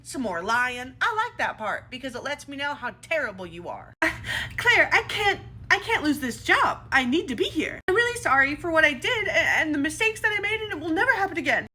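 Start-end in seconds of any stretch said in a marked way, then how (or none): 4.6-11.6 crocodile tears. Acted. Manager begs the owner to keep her job with crocodile tears. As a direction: beg for the job with put-on weepiness. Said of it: "manipulative little crocodile tear".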